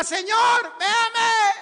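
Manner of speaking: high, drawn-out loud shout; full-voiced pleading yell; crying out to God. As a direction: call out to be seen by the Lord. Leading congregation in calling out.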